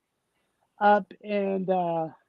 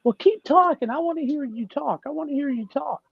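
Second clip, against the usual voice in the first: eager Canadians; 7 semitones higher than usual. Retold or imitated. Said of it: "they say".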